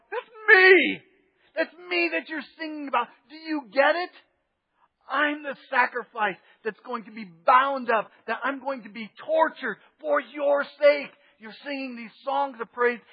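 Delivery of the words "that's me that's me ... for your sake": high, loud pleading cry; urgent cry. Retold or imitated. Jesus calling out to the singing crowd, urgent and high. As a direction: make them hear it's you. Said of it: "Jesus is going".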